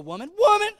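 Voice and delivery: put-on high, offhand brush-off of the girl; casual Peter waving off a servant girl; mock dismissive Peter. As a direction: toss it off like she's nobody. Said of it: "Like he calls the little girl".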